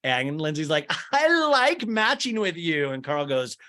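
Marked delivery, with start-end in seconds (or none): 1.1-2.9 high, slow, loud lovey-dovey imitation; mock-coo at a boyfriend; hammed-up sappy affection; gushing girlfriend.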